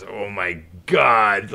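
loud, drawn-out retold gasp of awe; stunned disbelief. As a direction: stretch it out loud and slow, awestruck. Retelling his starstruck shock.

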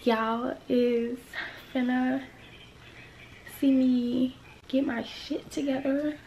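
hushed half-whisper to camera. Real, not acted. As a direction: keep it quiet, barely voiced. Hushed recording, voice low.

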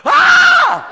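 high, loud shriek of surprise; a piercing happy scream, hands to mouth; delighted scream. Retold or imitated. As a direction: shriek with shocked joy. Waitress opens the gift.

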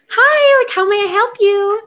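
very high, over-sweet server greeting; customer-service voice. Acted. Imitating herself greeting diners at the restaurant job, customer-service voice. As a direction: chirp it high and loud, like a put-on server. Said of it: "when I work my restaurant job".

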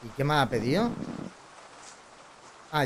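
ordinary talk

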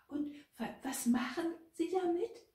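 high-pitched, stuttering question; anxious, squeaky-high and halting; frightened stammer. A narrator's reading. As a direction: stumble into the question in fear. Frightened Gertrud questions Else, stammering.

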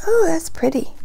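plain reading voice

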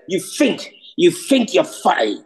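"think" said as "fink" in Cockney. Shown as a demonstration. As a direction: put an F in place of TH in "think". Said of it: "T H is pronounced with a F".

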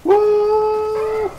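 excited whoop. Real, not acted. Cheering in game.

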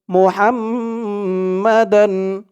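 slow "মুহাম্মাদান" with a droning nasal m; nasal ghunnah. Shown as a demonstration. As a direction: hold the doubled m of "মুহাম্মাদান" in a long hum. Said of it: "এখানে ওয়াজিব গুন্না".

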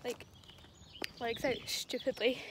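shivering with cold. Real, not acted. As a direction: talk while shivering in the cold. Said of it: "I'm shivering".

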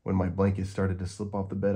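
ordinary delivery, nothing marked

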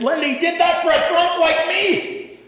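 high, loud cry of wonder; emotional outburst. Retold or imitated. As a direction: blurt it out, overwhelmed. Murray's tearful outburst retold.

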